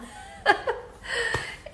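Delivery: breathy high laugh, half choked up; real embarrassed little laugh through tears; teary laugh. Real, not acted. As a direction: laugh it off as emotion rises. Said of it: "Now I'm gonna get emotional".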